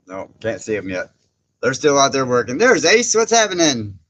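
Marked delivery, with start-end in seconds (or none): 2.6-4.0 loud, high, cheerful hello; excited greeting. Real, not acted. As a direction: call out to a friend joining the chat. Greeting a viewer loudly.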